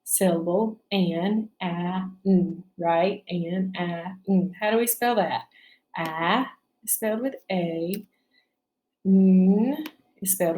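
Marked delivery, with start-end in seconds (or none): 0.9-4.5 "An" said whole, then split into "a, n"; teacher isolating each sound in "An". Shown as a demonstration. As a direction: segment "An" sound by sound. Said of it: "How many sounds in the first".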